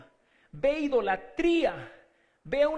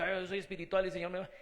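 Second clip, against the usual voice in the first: rushed, lower mock piety; a quick mocking aside in a pious voice; mock pious gushing; 5 semitones lower, 3 dB quieter, much faster than usual. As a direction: rattle it off like a self-important believer. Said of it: "No se necesita decir".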